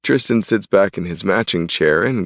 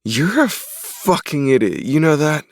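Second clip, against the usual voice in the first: higher exasperated scolding; 8 semitones higher than usual. A narrator's reading. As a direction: snap at your twin brother. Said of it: "Tristan's words".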